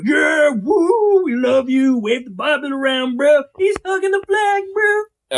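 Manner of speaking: high, loud idiot-supporter cheer; mock cheering fanboys. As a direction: whoop it up like an adoring crowd, mockingly. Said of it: "And it's like".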